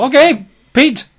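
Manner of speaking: breezy Jesus waving Peter out; playful Jesus voice. Retold or imitated.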